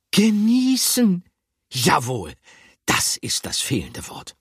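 triumphant cry that fades to a satisfied hush; eureka cry. A narrator's reading. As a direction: burst out with the answer, then settle. Picandou finds the word.

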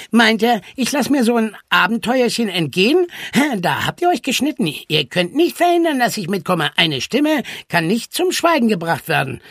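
nasal, animated self-important voice. A narrator's reading. Charlie insists on coming along, boasting nasally. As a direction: talk through the nose, cockily. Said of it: "näselte Charlie Gottchen".

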